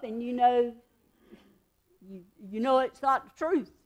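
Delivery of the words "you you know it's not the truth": ordinary delivery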